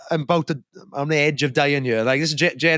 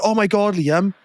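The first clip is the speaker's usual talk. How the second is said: high, imitated sisterly shock; mock alarm; 7 semitones higher, slower than usual. Older sister reading the tub's instructions, mock alarm. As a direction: re-enact a dramatic discovery, pitched up.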